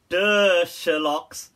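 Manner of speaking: loud mocking duh; mocking the obvious question; sarcastic duh. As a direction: rub it in, obviously. Sarcastic retort to asker.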